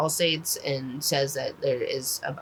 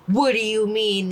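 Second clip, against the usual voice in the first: incredulous; 6 semitones higher, 5 dB louder than usual. Retold or imitated. Narrator confronting character in disbelief.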